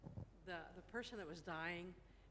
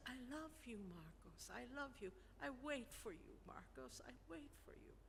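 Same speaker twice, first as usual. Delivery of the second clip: higher, soft imitation of a dying mother; 5 semitones higher, 5 dB quieter than usual. Dying mother tells Marcos she loves him, tender.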